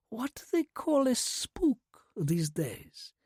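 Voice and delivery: soft, slow Russian whisper with a hint of a chuckle; amused Russian accent. A narrator's reading. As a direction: tease him gently in a Russian accent. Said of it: "There was a faint chuckle".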